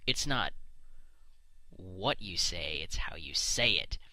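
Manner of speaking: slow, trailing off into a soft whisper; mock the saying as if it were deep wisdom. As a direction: parody of a profound saying, whispered away. Mocking the adage again.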